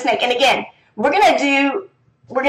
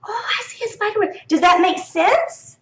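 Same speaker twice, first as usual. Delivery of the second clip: teacher echo; 9 semitones higher than usual. Shown as a demonstration. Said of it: "teacher echoes answer".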